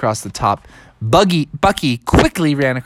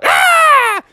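high, loud scream; piercing cartoon scream; 5 dB louder than usual. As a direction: shriek in panic. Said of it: "Tom Shane screamed out".

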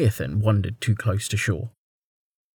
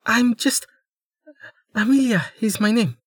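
high, pleading girl playing injured; a young woman faking helplessness, high and breathy with need; pitiful young woman; 12 semitones higher than usual. Amelia answering the armed man.

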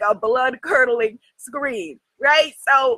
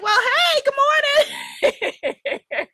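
casual frog voice; 10 semitones higher, much slower than usual. Retold or imitated. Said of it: "the frog is looking at me like".

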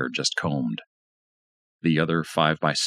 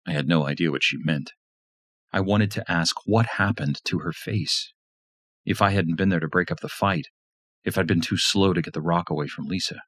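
ordinary delivery

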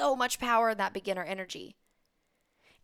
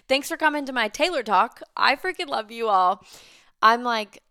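louder, gushing thanks; loud excited sign-off; 3 semitones higher, 9 dB louder than usual. Real, not acted. Host thanking her listeners in a loud, excited gush. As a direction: throw real joy at the listeners, louder.

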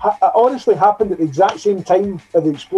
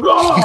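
sudden high startled shout; startled yelp; 10 semitones higher than usual. Retold or imitated. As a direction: jolt awake with a short yelp. Jolted awake from dream.

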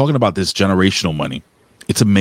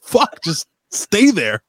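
high, drawn-out mock pleading; retold exasperation; 12 semitones higher, much slower than usual. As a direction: act out begging for silence, stretching each word. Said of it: "I'm like".

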